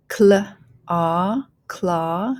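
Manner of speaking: "claw" built from cl and ah; teacher blending "claw" slowly; phonics blending. Shown as a demonstration. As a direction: sound out cl, ah, then join as "claw". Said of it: "go ahead and start blending".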